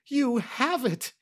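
high, drawn-out gasp of joy; joyful gasp. A narrator's reading. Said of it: "he gasped".

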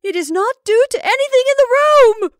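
very high, loud, shrill insistence; shrill terror. Acted. Rebecca contradicts her sister, shrill terror. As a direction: shriek the denial in terror. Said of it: "with the shrill insistency of terror".

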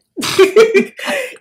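a burst of high, loud laughter. Real, not acted. Laughing at how her threat worked, big high laugh. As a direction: crack up at your own joke.

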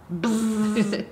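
buzzing buzzer noise; wrong answer buzzer. Pretend. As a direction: buzz like a game show buzzer. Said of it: "make a buzzer sound like this".

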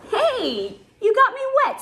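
high, loud cub protest; playful yell. A narrator's reading. Cub splashed by his friend, yelling. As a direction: yelp it out in mock outrage. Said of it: "Simba yelled".

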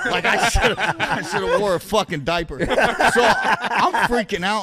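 laughing through the words; cracking up while telling it. Real, not acted. Laughing while telling shitting himself.

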